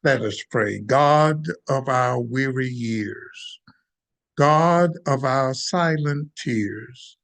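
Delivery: ordinary talk, nothing marked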